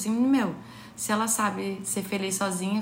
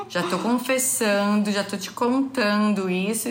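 fala lenta imitada; 3 dB louder, slower than usual. Retold or imitated. Ela repete a fala ao marido.

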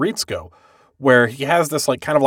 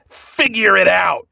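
mocking dismissal; 6 semitones higher, slower than usual. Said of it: "Kaoru does this thing that I, I hate".

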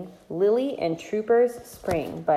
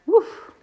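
high dog bark; 12 semitones higher, 6 dB louder than usual. Acted.

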